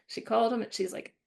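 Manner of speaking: ordinary delivery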